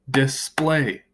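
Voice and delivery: display split into dis-play; display in two separated syllables. Shown as a demonstration. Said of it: "has two syllables".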